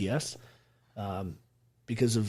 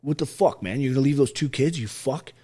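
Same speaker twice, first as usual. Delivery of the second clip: retelling a father's angry scolding, louder and higher as it builds; half-acted tough-love chewing out; imitating a furious dad laying into his son; dad lecture; 5 semitones higher, 6 dB louder than usual. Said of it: "John gives me the dad lecture".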